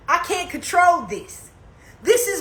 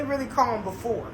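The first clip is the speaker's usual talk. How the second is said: usual speaking voice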